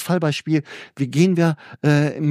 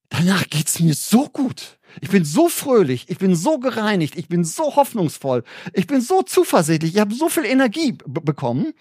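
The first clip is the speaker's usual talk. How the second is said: higher, lifted, beaming voice on each so; joyful glow; 6 semitones higher than usual. Retold or imitated. Preacher to congregation describing joy after repentance, beaming and lifted. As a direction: sound filled with fresh joy and hope.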